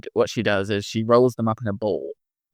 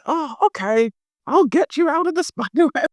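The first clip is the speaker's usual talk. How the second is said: Mrs Claus voice; 3 dB louder than usual. Acted. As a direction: play a fretful old Mrs Claus, much higher. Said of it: "she goes".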